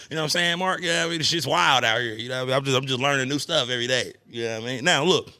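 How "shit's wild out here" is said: ordinary talk, nothing marked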